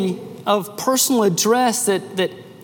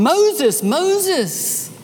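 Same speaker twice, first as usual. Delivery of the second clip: voice of God calling; 8 semitones higher than usual. Acted. Yahweh calling Moses from the burning bush, ringing high call. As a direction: summon him from within the bush.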